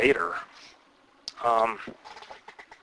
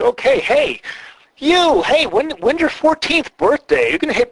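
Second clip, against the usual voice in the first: much higher and louder, teasing a scrawny wrestler; mock a puny kid, hammed-up; squeaky put-on taunt; 4 dB louder than usual. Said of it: "it's like".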